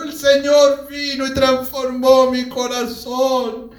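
high, loud, drawn-out cry; retold yell, stretched and insistent; desperate shouting. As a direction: proclaim it desperately to father. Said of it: "pero desesperado".

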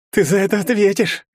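stunned by the blow, threatening; surprised threat. A narrator's reading. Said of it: "удивлённо произнёс тот". (удивлённо)

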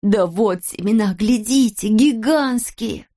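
voiced excited showing-off; proud announcement. A narrator's reading. Kolosok showing giant seeds, proudly excited. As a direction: stretch the final word in wonder.

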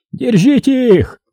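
high, drawn-out yell; high-pitched urgent command; shouted order. A narrator's reading. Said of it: "закричал Мадаев".

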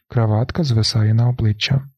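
usual speaking voice

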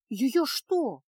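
slow, shocked question; aghast at the idea; horrified. A narrator's reading. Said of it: "ужаснулась Феска".

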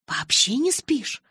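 high, loud, drawn-out disbelief; stunned. A narrator's reading. Girl asks Edward about never sleeping, stunned. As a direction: echo it slowly in amazement, pitch leaping up. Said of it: "ошеломлённо переспросила я".

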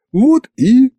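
sudden high squeaky break; high squeak. Acted. Соловей обрывает фразу. (внезапный высокий писк)

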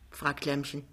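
ordinary delivery, nothing marked

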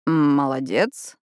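slow, baffled praise; puzzled. A narrator's reading. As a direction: say it slowly, unsure what she means. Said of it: "в замешательстве кивнул Рави".

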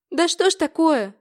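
высоко и громко, в панике; отчаянно, не понимая; в отчаянии. A narrator's reading. Трясёт отца за плечи. (дочь в панике над отцом)

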